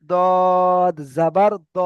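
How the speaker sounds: দদ জাবার দ very slow, ending on short দ not দা; slow drilled reading. Shown as a demonstration. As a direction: stretch out দদ জাবার দ for learners. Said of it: "দা বলবেন না কেউ". (ধীরে টেনে দদ জাবার দ, দা নয়)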